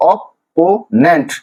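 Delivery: Opponent slowly split into three; syllable by syllable. Shown as a demonstration. Teacher repeating the division, opponent syllable by syllable. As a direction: pause between অপ, পো and নেন্ট in opponent. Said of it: "তিনটা সিলেবেলে ভাঙা হয়েছে".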